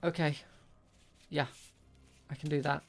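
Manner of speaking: ordinary delivery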